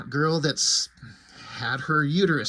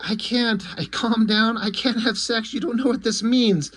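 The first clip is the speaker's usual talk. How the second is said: much higher, whining wail of self-pity; whiny despair; 8 semitones higher than usual. Retold or imitated. Jackie wails to Sonatine about losing sex, high whine. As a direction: wail it like a ruined man.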